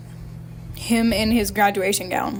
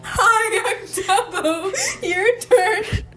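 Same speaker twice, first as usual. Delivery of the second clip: high mock demanding voice; 10 semitones higher, much slower than usual. Reading a match's message.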